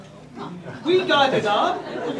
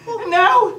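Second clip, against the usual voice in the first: higher, louder mock lament; hammed-up despair; anguished cry; 12 semitones higher, 6 dB louder than usual. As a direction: wail it like a soap opera. Lamenting his lost wife.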